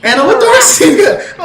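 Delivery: high, loud, slow indignation; indignant. Retold or imitated. Comparing himself to the wanted suspect, indignant. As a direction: hammer the absurdity home.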